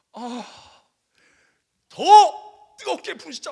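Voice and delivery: high, loud, slow impassioned prayer; fervent cry. Retold or imitated. Pastor urging heavier prayer, impassioned loud cry. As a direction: pour it out passionately. Praying harder, intensifying.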